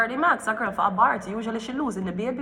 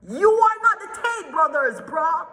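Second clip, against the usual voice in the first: mocking jab; 8 semitones higher than usual. Real, not acted. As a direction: toss it off with a pitched-up sneer. Dismissing [name] loudly.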